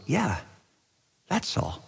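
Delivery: soft, smiling affirmation; gentle, unhurried voice of Jesus. Acted. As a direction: answer quietly with a fond smile. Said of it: "Jesus smiles and goes".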